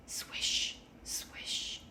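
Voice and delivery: soft unvoiced swishing of leaves; whispered swish. A narrator's reading. Leaves landing on the roof, whispered swish. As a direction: breathe out the swish like leaves falling. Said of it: "The leaves fell on the roof".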